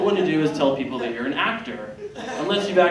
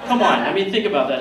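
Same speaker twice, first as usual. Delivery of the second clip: ordinary talk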